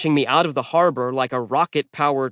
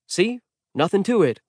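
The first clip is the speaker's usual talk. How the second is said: higher, easy see-how-simple tone; casual Percy boast; 7 semitones higher than usual. A narrator's reading. Percy surfacing to show Magnus, breezy boast. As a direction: shrug it off as nothing. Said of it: "his palms raised like".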